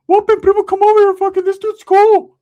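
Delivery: high, loud excited squeal; excited Ricky. Retold or imitated. Ricky calling excitedly on the phone.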